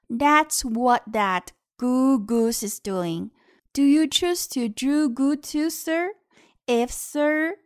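bouncy storybook reading; reading to a toddler. Acted. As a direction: read it out to a small child. Reads the book aloud to kids.

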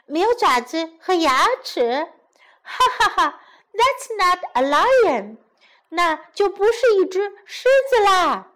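high girl's taunt with a mock laugh. Girl mocking the toothless lion, high and gleeful.

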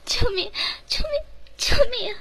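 high, desperate screams; screaming for help. Acted. Tortured maid screams for help, high and desperate. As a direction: shriek for help in pain.

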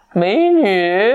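high, leering "美女" with a laugh; sleazy flirt. Acted. Narrating the prince to a friend, leering flirt. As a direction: ham up a cheeky pickup line. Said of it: "你好贱啊，这声音".

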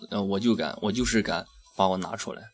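ordinary talk, nothing marked

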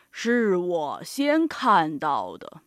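low, slow elephant voice; slow, deep protest in a quarrel; arguing elephant. Acted. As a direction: drawl it low and heavy. Elephant argues over crown.

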